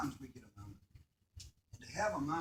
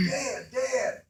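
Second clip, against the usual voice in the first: higher, louder eager call to Dad; excited child calling; 9 semitones higher, 10 dB louder than usual. Retold or imitated. Son calling his father over to the turkey, excited shout. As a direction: call out like a thrilled young son.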